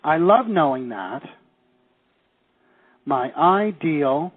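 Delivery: words spaced out slowly for writing down; slow dictation. Shown as a demonstration. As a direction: dictate it slowly so they can write. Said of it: "I want you to write this first one".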